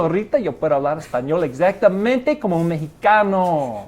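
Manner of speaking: proud Mexican Spanish accent, like a local; Mexico City Spanish. Shown as a demonstration. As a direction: show off a native-sounding Mexican Spanish accent. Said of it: "What'd you think of Mexico City?".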